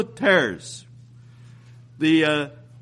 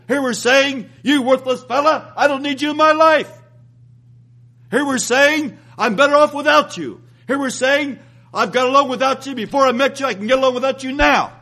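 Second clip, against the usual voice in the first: higher, louder contemptuous lines; scornful rejection; 6 semitones higher, 4 dB louder than usual. Retold or imitated. Preacher voicing a brother's scornful insults, raised and contemptuous. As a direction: sneer each rejection.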